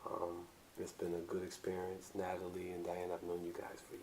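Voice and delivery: low, soft, smooth talk; lightly done bedroom voice; sexy voice. Acted. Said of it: "Was that your sexy voice?".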